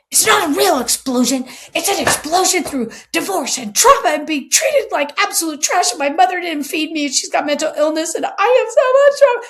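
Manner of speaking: loud, high, distressed rant; upset outburst. Retold or imitated. Golfer unloading his trauma on the cart, loud and high. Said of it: "he starts sobbing".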